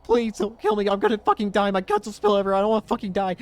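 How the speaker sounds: high, loud, frantic pleading for his life; a terrified young man screaming for mercy; panicked begging. Acted. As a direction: beg like you're about to be killed.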